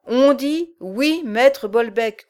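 slow, loud growling giant; dim slow booming giant. Acted. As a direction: growl each word out slowly. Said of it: "grogna le géant".

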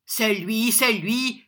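loud, high mocking echo; crying out. A narrator's reading. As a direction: call it out boldly. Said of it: "cria John".

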